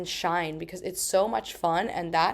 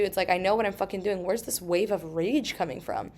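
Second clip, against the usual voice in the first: usual speaking voice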